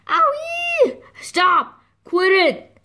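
high, hammed-up cries of protest; pained yelping. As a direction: yelp and beg them to quit. Mommy pecked in rough game.

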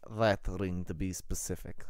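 put-on low, quiet Russian-accented prelate; dry clarification in a Russian accent. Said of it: "at the Russian accent".

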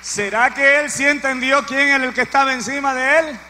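full-force preacher's cry; preaching shout. Real, not acted. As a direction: hurl the question at the congregation. Preacher repeats question loudly.

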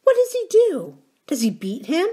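high, breathless eager question; thrilled interest. A narrator's reading. Girl thrilled by a dark secret, high and eager. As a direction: gasp out the question. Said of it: "She shivered and tensed with interest".

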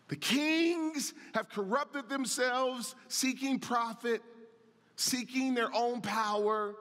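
rolling sermon cadence, pressing each point; preaching at full force. Acted. Pastor warning the congregation about sin, preaching at full force. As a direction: drive the message home to the church.

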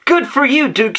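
bright high call to his dog; cry out. A narrator's reading. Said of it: "Sammy cried".

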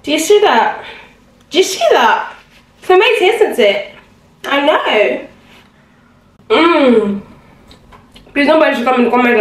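swooping high, playful affected voice; put-on voice. Vlogger showing viewers something amazing, playful put-on voice. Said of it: "asking me why I'm talking like that".

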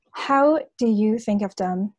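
ordinary talk

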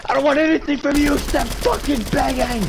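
dramatic pleading. Acted. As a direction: overact a desperate plea. Dramatic scorned roleplay.

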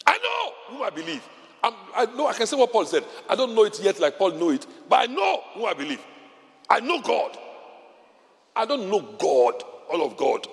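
high, ringing proclamation of faith; preaching declaration. Real, not acted. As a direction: proclaim it to the congregation.

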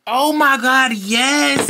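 normal talking voice

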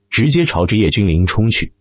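ordinary delivery, nothing marked

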